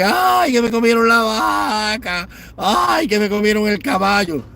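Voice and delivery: shrill falsetto parody of a complaining Cuban; mock whiny lament. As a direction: whine it like a melodramatic victim crying over stolen livestock.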